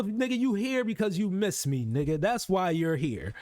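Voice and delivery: ordinary talk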